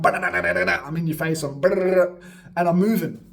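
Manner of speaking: loud, slow and right up close; in-your-face battle energy. Shown as a demonstration. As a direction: get in their face like a beatbox battle. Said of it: "So I'm battling you".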